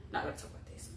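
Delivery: hushed, embarrassed whisper; whispered aside. Real, not acted. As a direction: drop to a whisper, half to yourself. Embarrassed aside about spare tire.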